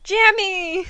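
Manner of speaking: a high, loud call for the son; screaming for his son. Acted. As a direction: call his name across the hall in panic. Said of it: "He screamed as loud as he could".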